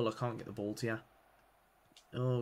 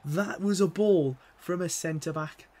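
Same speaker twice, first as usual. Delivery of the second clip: amazed; 7 semitones higher, 9 dB louder, slower than usual. Real, not acted. Marvelling at the pass.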